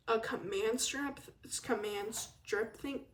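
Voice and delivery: ordinary talk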